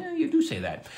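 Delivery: high-pitched imitation of colleagues; mimicked agreement. Other writers tell the showrunners so, high mock voice. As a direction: put on a high voice, teasingly confirming. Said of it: "the other writers are going".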